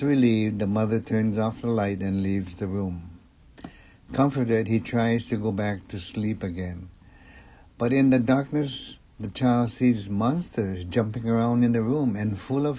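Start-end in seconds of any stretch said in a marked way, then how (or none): none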